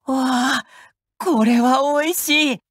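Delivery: light up at the first bite; charmed gasp at good food; delighted tasting. Acted. Tasting food given by friend.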